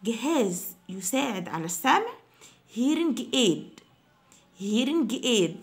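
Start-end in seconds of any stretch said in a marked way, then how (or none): 2.8-5.6 hearing aid said slowly, hearing stressed; slow model. Shown as a demonstration. Teacher slowing down the word for students. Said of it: "review, modelled slowly".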